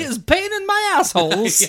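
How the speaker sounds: put-on high-pitched comic Kazakh-style accent; Borat. Co-host joins the cousin joke, high comic foreign accent. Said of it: "Borat's cousin".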